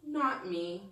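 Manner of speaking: ordinary delivery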